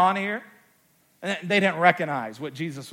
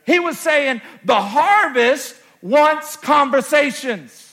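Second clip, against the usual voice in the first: loud, high, slow proclamation; preacher at full force; preaching climax; 9 semitones higher, 8 dB louder, slower than usual. Acted. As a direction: hammer the point home. Preacher drives home point.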